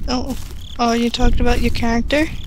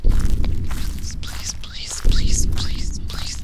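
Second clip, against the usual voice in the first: desperate whispered begging; pleading whisper; much slower than usual. Real, not acted. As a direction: beg under your breath. Begging while hiding in game.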